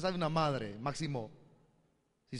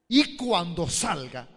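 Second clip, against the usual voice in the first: firm declaration; 3 semitones higher, 8 dB louder, much slower than usual. Retold or imitated. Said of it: "así se lo dije".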